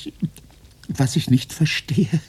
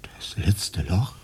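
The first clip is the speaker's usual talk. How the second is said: frightened whisper; 11 semitones lower, 7 dB quieter, slower than usual. Acted.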